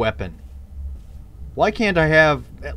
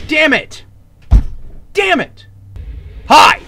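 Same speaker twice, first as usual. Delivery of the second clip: higher, louder cursing; frustrated yell; 11 semitones higher, 7 dB louder than usual. Real, not acted. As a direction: shout the curse in frustration. Getting hit in game.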